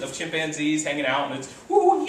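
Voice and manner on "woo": high laughing woo; excited party whoop. Retold or imitated. Lecturer whooping like a party chimp.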